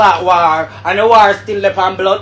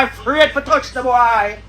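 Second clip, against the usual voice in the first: ordinary talk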